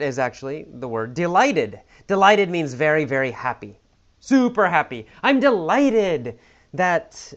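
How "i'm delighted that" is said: higher, louder drawn-out mock delight. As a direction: act super happy, stretching it out slowly. Acting out being super happy.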